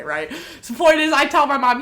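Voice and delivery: ordinary talk, nothing marked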